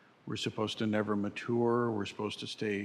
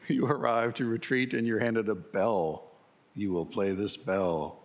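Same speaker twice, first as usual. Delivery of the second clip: commanding villain decree; lordly villain voice, mock menace; booming echoing villain monologue; 4 dB louder than usual. Villain voice handing out bell.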